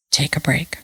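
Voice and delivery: breathed, barely voiced; soft whisper. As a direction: whisper it gently, like calm advice. Husband's calm mirror, quoted softly.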